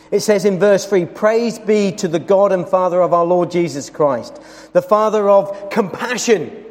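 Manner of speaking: usual speaking voice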